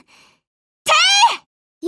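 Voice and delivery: high, loud "てー!" shouted fire order; sharp shouted fire command; battle cry. Acted.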